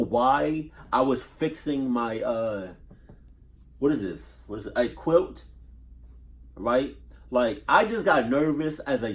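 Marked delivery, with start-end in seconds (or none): none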